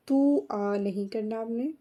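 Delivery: usual speaking voice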